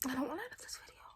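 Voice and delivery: soft whispered aside. Real, not acted. Vlogger whispering to viewers after signing off, in a soft whisper. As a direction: breathe it out in a whisper.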